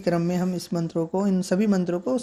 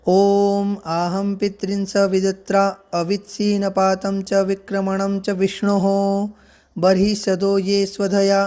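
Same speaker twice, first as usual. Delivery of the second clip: measured, slow Vedic chanting cadence; mantra recitation; 4 dB louder, slower than usual. Acted. Teacher intoning the Pitru Sukta mantra for learners, slow chant. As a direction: recite it like a priest intoning a sacred verse.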